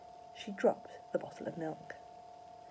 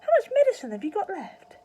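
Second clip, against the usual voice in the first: high, loud demand; loud, high eager father; shouting; 13 semitones higher, 11 dB louder than usual. A narrator's reading. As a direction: shout it urgently. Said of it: "Mister Cranky shouted".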